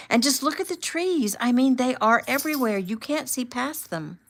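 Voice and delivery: high-pitched, excited gushing over the view; amazed. A narrator's reading. Jordan can't stop talking about the scenery, amazed. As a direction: marvel aloud, pitched well up.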